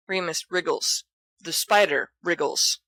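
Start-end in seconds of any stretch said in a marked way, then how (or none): none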